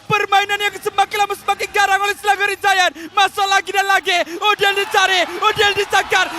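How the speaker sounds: loud, rising hype call; excited play-by-play. Real, not acted. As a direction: shout the action as it happens. Teamfight kills, caster hype. (ulasan langsung yang penuh teruja)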